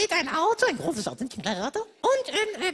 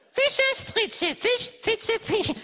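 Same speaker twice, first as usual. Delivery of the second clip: slow careful tongue twister; 5 semitones higher, much slower than usual. Shown as a demonstration. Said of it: "Konzentrier dich".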